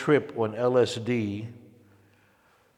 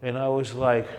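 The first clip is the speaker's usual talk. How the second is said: ordinary talk, nothing marked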